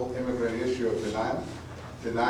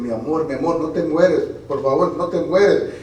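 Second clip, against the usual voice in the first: weeping plea; 4 semitones higher, 8 dB louder than usual. Acted. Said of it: "I weep".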